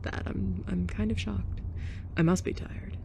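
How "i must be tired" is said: ordinary delivery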